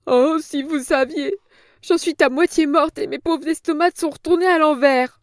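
put-on a cringing old woman protesting; frightened old crone. A narrator's reading. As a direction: plead like a scared old hag.

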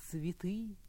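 whispering. Acted. Whispered line of poem.

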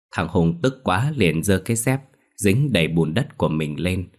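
ordinary delivery

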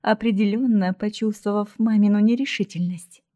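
ordinary delivery, nothing marked

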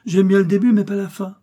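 high little mouse voice, starting loud; cheeky child voice. A narrator's reading. Little mouse answering her parents back, cheeky and high.

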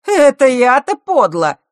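loud, high, drawn-out fake astonishment; Марк, feigned surprise. A narrator's reading. Bully answering the girl's charge, falsely amazed. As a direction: act astonished, laying it on thick. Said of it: "фальшиво удивился Марк".

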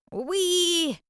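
high happy "Уи"; excited squeal. Acted. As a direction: squeal it with glee. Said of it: "воскликнули они".

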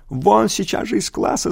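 high yell. A narrator's reading. Teacher yelling a pupil out of class, high yell. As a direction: throw him out with a yell. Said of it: "заорал Монохордов".

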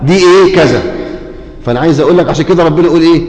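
usual speaking voice

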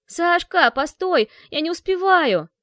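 panting, high yell while running; out of breath shouting. A narrator's reading. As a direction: gasp it out, being chased. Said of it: "Задыхаясь, выкрикнула я". (задыхаясь, кричит на бегу)

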